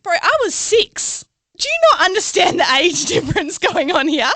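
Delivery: hammed-up outrage; mock indignant. Telling the older man off, mock indignant. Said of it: "I was like".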